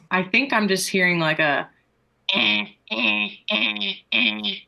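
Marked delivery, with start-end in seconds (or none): 2.3-4.5 imitated truck reversing beeps; evenly spaced "eh" beeps; vocal reverse alarm in a character rasp; backing-up beep. Shown as a demonstration. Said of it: "it was a literal backing up noise".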